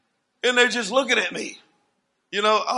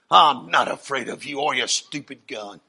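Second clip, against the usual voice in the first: low-pitched, steady defiance; retold fearless challenge in a low voice; 7 semitones lower than usual. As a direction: stand up to him, pitched low. Retold defiance of an armed pimp.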